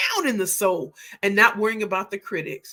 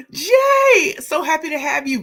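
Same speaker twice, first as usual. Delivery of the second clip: excited greeting; 8 semitones higher, 7 dB louder than usual. Real, not acted. Greeting a viewer on stream.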